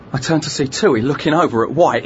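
plain reading voice

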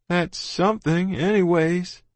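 put-on sulky boy conceding a little; gloomy and angry. A narrator's reading. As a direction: drag it out, mildly sore. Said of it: "He was pretty gloomy about it, and angry".

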